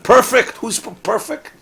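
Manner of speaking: high, loud mock disbelief; "Perfect?" thrown up high and loud; incredulous.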